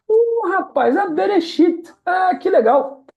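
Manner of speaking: delighted, like the student just won; excited praise. Real, not acted. Teacher cheering student who read Bereshit, excited high praise. Student reads first word right.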